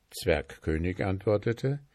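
ordinary delivery

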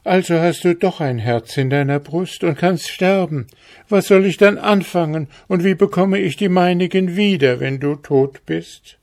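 put-on a girl clinging and pleading; lamenting girl. A narrator's reading. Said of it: "fing sie wieder an zu klagen". (hohe, klagende Mädchenstimme)

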